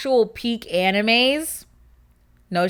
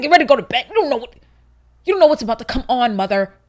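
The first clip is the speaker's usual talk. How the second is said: loud, higher-pitched mock outrage; indignant retort; 4 semitones higher, 9 dB louder, much faster than usual. Child talking back to mother about bedtime, indignant retort. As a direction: fire the order back in disbelief, rushing on.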